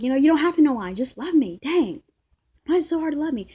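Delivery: quoting her outburst. Retold or imitated. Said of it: "She's like".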